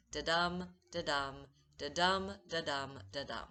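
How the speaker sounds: "da-dum" with the dum hit hard, fading; da-dum beat. Shown as a demonstration. Teacher tapping out iambic meter for students, chanted beat. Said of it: "That rhythm, or meter".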